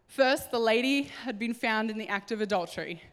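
usual speaking voice

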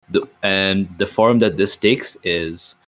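ordinary talk, nothing marked

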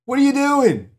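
high, loud shout across the room; startled resident yelling; yelled. Retold or imitated. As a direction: call out loudly at Alan.

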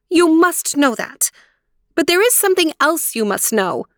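high, resolute princess; firm voice. A narrator's reading. Aurora declaring her decision to three aunts, firm. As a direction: stand your ground, emphatic and high. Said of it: "in a firm voice".

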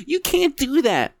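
high, squealing mock victim; high mock-wounded whine; whiny shoplifter. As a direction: squeal it like a caught thief playing hurt. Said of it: "as soon as she puts her hands on".

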